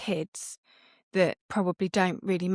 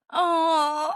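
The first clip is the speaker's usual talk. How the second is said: mock pity; 9 semitones higher, 5 dB louder than usual. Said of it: "I'd go".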